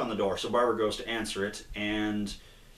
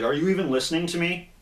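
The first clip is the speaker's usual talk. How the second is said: higher, louder and fast, like a fed-up Batman; mock angry outburst; 7 semitones higher, 4 dB louder, faster than usual. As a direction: snap the question at him in mock anger. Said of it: "He's like".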